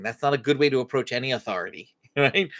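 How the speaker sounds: normal talking voice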